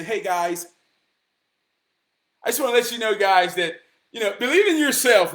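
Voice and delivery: lightly voiced fake-positive influencer; fake motivational speaker. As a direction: parody a phony hype guy, lightly voiced. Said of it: "fuck being fake like that".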